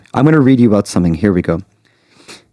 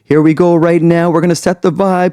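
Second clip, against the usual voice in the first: higher-pitched event announcer, building steadily in pitch to the count; MC announcement; 6 semitones higher than usual. Acted. Planner cueing the DJ over music, rising MC announcement. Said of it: "making announcements ... after I'm like".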